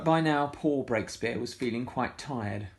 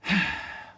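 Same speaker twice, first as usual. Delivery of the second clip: breathy weary sigh; 7 semitones higher than usual. A narrator's reading. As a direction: sigh after the crash. Picked herself from the wreckage.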